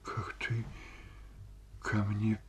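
hushed, breath-filled sigh; slow, near-whispered hurt; sighed reproach. A narrator's reading. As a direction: sigh it softly, with long pauses. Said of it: "вздохнула Ольга Ивановна".

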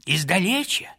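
a hint of a frail old pilgrim; gentle old man speaking from afar; old man's voice. A narrator's reading. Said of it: "отвечал другой старческий голос".

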